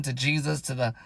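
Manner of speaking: ordinary delivery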